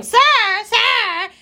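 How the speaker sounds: high mock call after someone; imitating staff calling out to a shoplifter. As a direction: call him back across the store. People calling after the thief.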